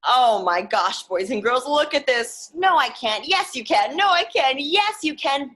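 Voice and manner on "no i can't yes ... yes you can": bickering back and forth. Acted. Back-and-forth story argument.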